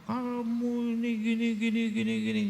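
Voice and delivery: hammed-up bickering; mock nagging spouse. Husband nagging wife in a quarrel, mock nagging.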